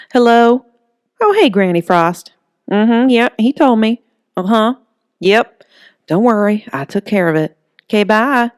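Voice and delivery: Holly on the phone. Acted. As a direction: chat brightly with Granny.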